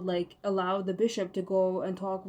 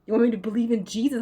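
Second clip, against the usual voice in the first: louder and faster indignant challenge; angry; 7 dB louder, faster than usual. Real, not acted. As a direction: fire the question at them, fast and loud. Rant against God.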